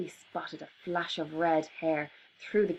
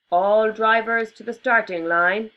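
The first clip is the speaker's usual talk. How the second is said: loud announcer over a crackly loudspeaker; loudspeaker announcement; 3 semitones higher, 8 dB louder than usual. Acted. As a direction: call it out like a tannoy. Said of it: "a voice crackled over a loudspeaker".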